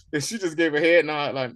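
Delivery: usual speaking voice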